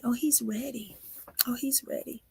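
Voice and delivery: hushed. Real, not acted. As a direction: say it quietly, almost to yourself. Building prophecy about the baby.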